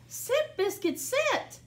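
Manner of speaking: high, slow firm command to a puppy; gently firm command to the puppy; mock command. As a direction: tell the dog to sit. Commanding the dog.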